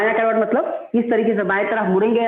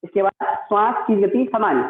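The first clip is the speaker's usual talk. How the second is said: usual speaking voice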